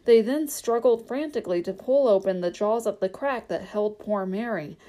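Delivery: ordinary delivery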